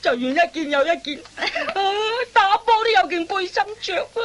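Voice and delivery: half-laughing, half-crying complaint; mock whimpering. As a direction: whine through the stripping, pitiful. Forced to strip, whimpering. (半哭半笑咁訴苦)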